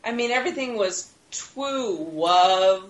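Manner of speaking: "twoo" and "wov" with a lisping w for r and l; lisp imitation. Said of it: "he had a lisp as well".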